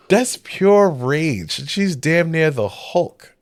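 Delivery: ordinary talk, nothing marked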